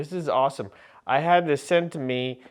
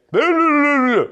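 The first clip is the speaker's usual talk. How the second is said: very high, loud sustained blah; shrill cop gibberish; 14 semitones higher, 10 dB louder than usual. Retold or imitated. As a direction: squeal the cop's warning as nonsense. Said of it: "which means".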